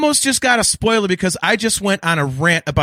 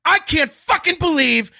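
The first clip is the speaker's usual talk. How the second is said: slow, high, yelled curses; furious shout; 8 semitones higher, slower than usual. Real, not acted. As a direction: roar it out in real outrage. Furious rant about the Purgles.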